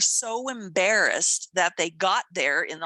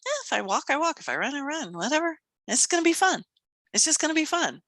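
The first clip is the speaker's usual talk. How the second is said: light, higher-pitched shrug of a voice; carefree; 7 semitones higher, faster than usual. Retold or imitated. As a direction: toss it off like you don't care. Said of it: "because I didn't care".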